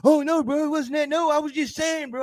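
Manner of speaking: high, sing-song mock explaining; hammed-up nervous excuse; backpedaling excuse. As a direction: imitate someone nervously walking it back. Acting someone backpedaling nervously.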